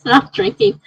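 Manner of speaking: high laugh into words. Real, not acted. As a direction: laugh at yourself. Laughing at own fear.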